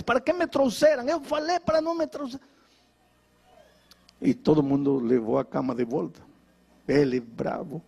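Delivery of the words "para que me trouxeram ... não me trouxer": yelling at parents. Retold or imitated. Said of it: "começou a gritar com os pais".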